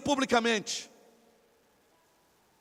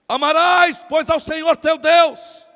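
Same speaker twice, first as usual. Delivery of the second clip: loud, high declamation; memorised recitation; 4 semitones higher, 9 dB louder, slower than usual. Acted. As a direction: recite it loudly like a learned speech. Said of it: "tava decorado". (discurso decorado, declamado alto)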